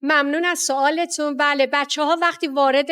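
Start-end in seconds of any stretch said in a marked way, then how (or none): none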